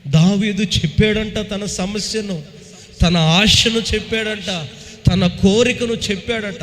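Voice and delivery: loud, high-pitched sermon delivery; fervent preaching. Acted. Preacher rousing the congregation about David's cry, loud and high. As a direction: proclaim it to the whole hall.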